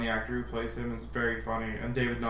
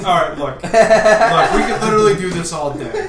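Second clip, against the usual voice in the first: laughing, higher and louder, trying to regain control; 7 semitones higher, 12 dB louder than usual. Real, not acted. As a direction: crack up while trying to steer back. Laughing while trying to move on.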